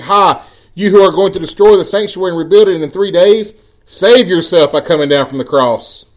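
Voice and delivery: jeering passers-by. A narrator's reading. Passers-by to Jesus on the cross, mocking. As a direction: read it as a jeer at the cross. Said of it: "those passing by were blaspheming him".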